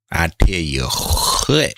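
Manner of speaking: slow, drawn-out Southern country drawl on "I tell you what"; country accent. Shown as a demonstration. Streamer to chat, hamming up a country accent. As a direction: lean into a thick country accent, stretching it out. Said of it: "I had to hit my country accent".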